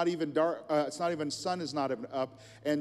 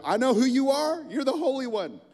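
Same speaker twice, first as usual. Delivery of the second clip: high, loud possessed shriek; demon voice; 11 semitones higher, 9 dB louder than usual. Acted. Possessed man shrieking at Jesus, high and loud. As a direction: cry out like a demon caught in the open.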